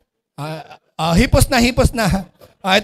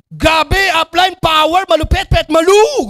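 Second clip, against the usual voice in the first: overeager recruit pumped to bursting; overexcited; 5 semitones higher, 4 dB louder than usual. Acted. Recruit answering upline's check-in, bursting with overexcitement. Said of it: "Sa sobrang gigil eh".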